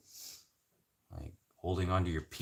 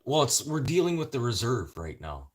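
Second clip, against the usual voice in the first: normal talking voice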